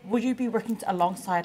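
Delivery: ordinary talk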